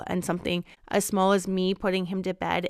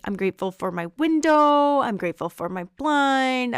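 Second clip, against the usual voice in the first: put-on light, higher little-boy voice, a touch louder; imitating her son; 5 semitones higher, 5 dB louder than usual. Mom mimics her little son listing what he's grateful for, lightly. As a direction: mom doing her three-year-old's sweet grateful list.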